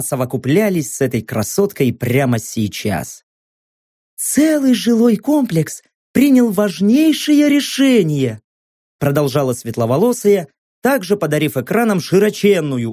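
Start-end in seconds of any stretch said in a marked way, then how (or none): 4.2-8.4 bright, slow announcer voice; high, gushing TV presenter; TV host voice. Acted.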